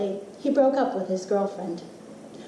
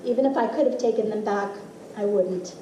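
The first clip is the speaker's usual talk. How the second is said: ordinary talk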